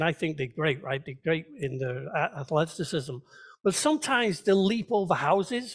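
usual speaking voice

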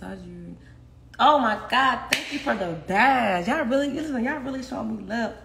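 ordinary talk

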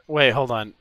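normal talking voice

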